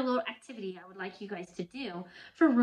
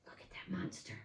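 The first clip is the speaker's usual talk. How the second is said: unvoiced excited whisper; 4 semitones lower, 9 dB quieter, faster than usual. Real, not acted. Whispered aside to children.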